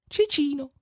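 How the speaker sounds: very high squeaky little name; tiny child voice. Acted. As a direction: chirp the name like a tiny boy. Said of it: "disse il bambino".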